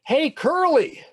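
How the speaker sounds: high, loud taunting call; yelling. Acted. Benny calls out to the boy at recess, yelling. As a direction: holler it across the schoolyard. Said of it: "he keeps yelling".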